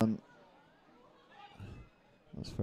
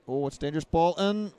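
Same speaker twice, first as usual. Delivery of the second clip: excited call; 7 semitones higher than usual. Real, not acted. Commentator calls a dangerous cross.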